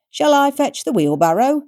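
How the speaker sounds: cheerfully morbid aunt; the aunt offering to cart the body off; gleeful old biddy. A narrator's reading. Second crone, cheerful menace.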